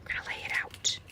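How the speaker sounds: breathy whispered echo of the line; soft whispered aside; whispered repeat. Shown as a demonstration. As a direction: whisper it back to yourself. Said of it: "I'm a visual girl".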